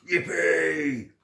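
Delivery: a bright cry of joy; cheer. A narrator's reading. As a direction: cheer it out loud. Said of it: "cried out".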